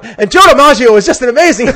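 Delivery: high, loud, put-on voice of an excited old Yankee fan; gushing old fan. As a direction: gush about DiMaggio like an old man who lit up.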